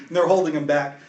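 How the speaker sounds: ordinary talk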